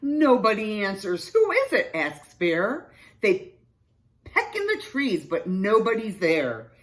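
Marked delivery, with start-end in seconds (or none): 1.3-1.9 high, loud questioning call. A narrator's reading. Bear asks an unseen visitor, calling out. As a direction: call it out to whoever is hiding.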